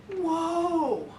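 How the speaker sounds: put-on high, drawn-out child's protest; objecting young son. Son objecting to dead dad jokes, imitated protest. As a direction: imitate a little boy calling a halt.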